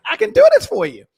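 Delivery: mock eager high offer; eager pitch. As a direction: sell it like you're itching to help. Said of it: "And I'm like".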